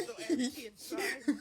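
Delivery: squealing laugh through words; laughing hard. Real, not acted. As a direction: crack up while talking. Laughing about the soulless eyes.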